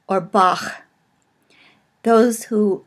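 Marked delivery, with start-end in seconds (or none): 0.2-0.8 "Bach" ending in a back-of-throat ch; guttural ch. Shown as a demonstration. Teacher giving an example of the guttural, back-of-throat ch. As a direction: end "Bach" on a German-style guttural. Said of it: "pronounced in the back of the throat".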